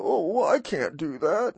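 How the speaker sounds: high, whiny give-up voice, a little mocking; mock defeated whine. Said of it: "and go".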